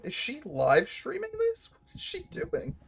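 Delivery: baffled squeal. Real, not acted. Gamer baffled by a character in the cutscene, squeaky high disbelief. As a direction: squeak it out in confusion.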